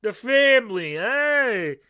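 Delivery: loud Italian gangster voice; hammed-up mafia boss; mobster accent. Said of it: "And the Capones".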